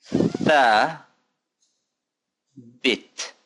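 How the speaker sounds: THA held long, pause, then BIT; syllable by syllable. Shown as a demonstration. Teacher asking learners to pronounce along, slow split syllables. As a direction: break it up for repeating, THA ... BIT. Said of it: "Once more".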